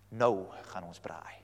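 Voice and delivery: hushed joking Afrikaans whisper; whispered aside. Acted. Preacher joking that Elijah says let's braai, whispered. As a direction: whisper it as a punchline.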